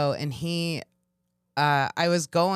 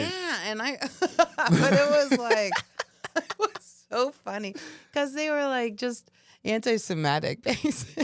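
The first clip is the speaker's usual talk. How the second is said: laughing; 8 semitones higher than usual. Real, not acted. Laughing while telling the story.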